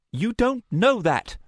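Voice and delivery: high, loud retort with an edge; tense, high-pitched protest; edged. A narrator's reading. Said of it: "There was an edge in Luna's voice".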